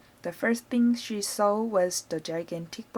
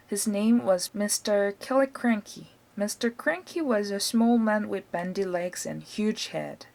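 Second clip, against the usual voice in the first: usual speaking voice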